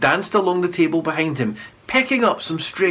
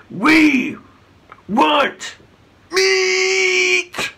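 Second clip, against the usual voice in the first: demanding shout; 13 semitones higher, much slower than usual. A narrator's reading. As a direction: punch every word out. Chief demands meat.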